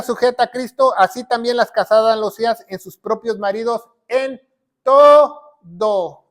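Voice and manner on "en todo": "en todo" drawn out, loud on "todo"; "en todo" slowly split up, with a loud TO; emphatic stress. Shown as a demonstration. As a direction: hammer "todo", stretching each syllable. Stresses scripture's words, syllable by syllable.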